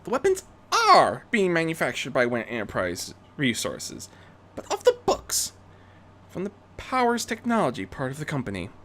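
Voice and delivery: Rarity briefing. Acted. Rarity briefing Bruce on the weapons, high and urgent. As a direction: lay out the facts urgently.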